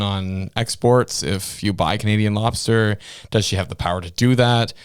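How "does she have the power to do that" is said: normal talking voice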